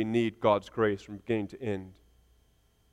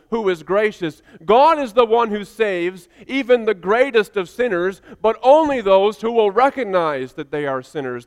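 raised, higher, driving pulpit delivery; full-force preaching; 8 semitones higher, 8 dB louder than usual. Real, not acted. Preacher telling congregation where scripture puts the emphasis, full-force preaching. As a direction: preach it in insistent, mounting repetitions.

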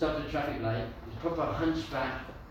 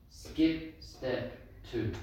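drawn out, each word spaced; the key rule, hammered home very slowly; very slow emphasis; much slower than usual. Shown as a demonstration. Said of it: "important method".

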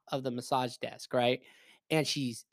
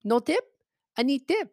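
high, insistent mock Chinese accent; imitating Chinese-accented old lady; 10 semitones higher than usual. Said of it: "She goes".

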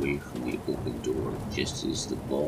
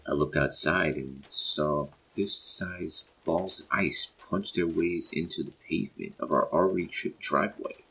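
usual speaking voice

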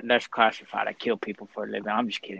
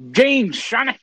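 put-on high, loud old codger bark; cranky old-timer; 12 semitones higher, 8 dB louder, slower than usual. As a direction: bark it like a grumpy grandpa. Old-timer exclaiming answer.

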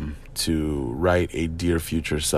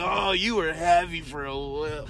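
high, put-on straining voice; hammed-up comic exertion; mock triumph; 12 semitones higher than usual. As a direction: play it like hauling in a huge catch. Acts out reeling in reluctant viewer.

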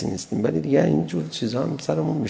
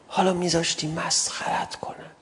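rebuking whisper; 4 semitones higher, 6 dB quieter than usual. Acted. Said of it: "از جانب امیرالمومنین یه حرفی به زبیر بزنم".

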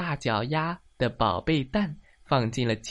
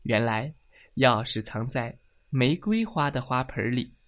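usual speaking voice

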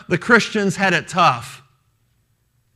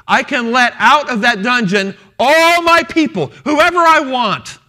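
much higher and louder, declaring victory; triumphant proclamation; 8 semitones higher, 8 dB louder than usual. Acted. Jesus speaking as told by the preacher, triumphant loud declaration. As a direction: shout it out as the one holding the keys.

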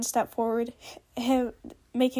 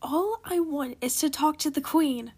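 raised, higher-pitched mild shout; 4 semitones higher, 4 dB louder than usual. A narrator's reading. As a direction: demand it of the guard in a raised voice. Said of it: "Nala shouted at the guard".

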